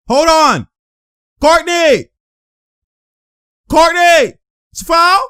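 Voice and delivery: insistent high-pitched holler; calling out. Real, not acted. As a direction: call the name out high and sharp. Calling out during the game.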